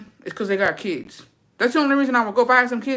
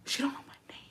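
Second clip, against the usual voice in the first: breathy whispered aside; 8 semitones higher, 13 dB quieter, much faster than usual. Retold or imitated. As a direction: whisper it like a secret.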